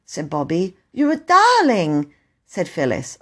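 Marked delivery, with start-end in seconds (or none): 0.9-2.1 high, slow gush of gratitude; little girl thanking her rescuer; adoring thanks. Acted. Phyllis thanks station master.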